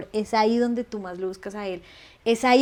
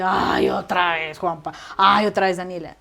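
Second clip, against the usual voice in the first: mock exasperated God; 4 semitones lower, 4 dB louder than usual. Imagining God tired of her confessions, mock exasperated. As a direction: play an annoyed, fed-up voice. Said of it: "Y Dios no dice".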